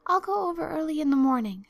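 high, loud, eager offer. A narrator's reading. Anne offers Marilla a morning errand instead, eagerly. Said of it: "said Anne eagerly".

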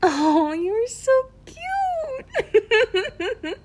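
very high, slow, laughing coo; laughing adoringly. Acted. Laughing at the listener's Russian, high adoring coo. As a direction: melt laughing at his try.

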